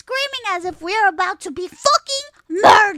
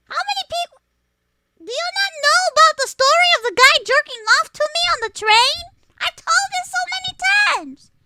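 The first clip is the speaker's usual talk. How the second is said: high, disbelieving; can't believe you haven't heard this; incredulous, higher than usual; 10 semitones higher, 4 dB louder than usual. Real, not acted. Incredulous she never heard story.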